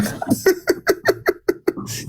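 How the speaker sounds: shrieking laugh, high and airy; high burst of laughter. Real, not acted. Loud high laugh at insult.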